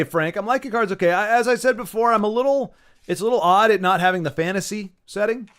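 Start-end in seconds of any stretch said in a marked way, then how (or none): none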